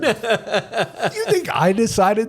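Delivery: high, squeaky laughing protest. Retold or imitated. New guy protesting to the sergeant, laughing high. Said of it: "I'm like".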